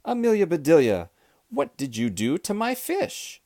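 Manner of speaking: high-pitched outraged demand; exasperated storybook shout; angry shout. A narrator's reading. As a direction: demand an answer in alarm. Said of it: "he shouted".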